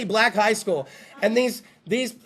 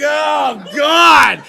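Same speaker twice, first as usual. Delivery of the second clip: panicked cry; 3 semitones higher, 8 dB louder than usual. Acted. Comic imagining the ordeal, crying out in panic. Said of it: "I'm like".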